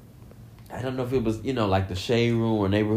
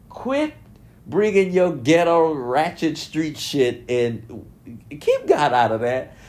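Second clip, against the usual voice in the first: high, slow telling-off; scolding; 5 semitones higher than usual. Acted. Scolding the rapper for dragging God into it, high and slow. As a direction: scold him like a fed-up aunt.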